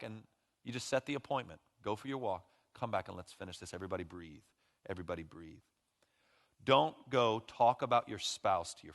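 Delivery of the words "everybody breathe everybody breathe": much softer, calming everybody breathe; calming hush. Real, not acted. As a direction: settle the room gently. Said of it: "calming the congregation".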